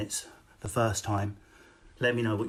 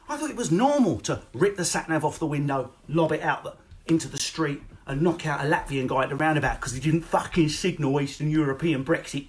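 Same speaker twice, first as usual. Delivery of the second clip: ordinary talk, nothing marked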